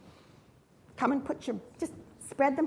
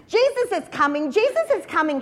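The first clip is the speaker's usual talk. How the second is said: higher-pitched storyteller's cry of news; mild excited shout; 6 semitones higher, 4 dB louder than usual. A narrator's reading. Children running ahead of Jesus, mildly shouting. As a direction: call it out eagerly, short of a full shout. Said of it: "shouted children running in the street".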